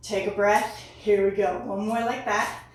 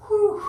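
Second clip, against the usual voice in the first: loud relieved whew after the lunges; after a workout; 9 semitones higher, 7 dB louder than usual. Real, not acted. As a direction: blow out a tired, high whew. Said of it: "Legs are burning".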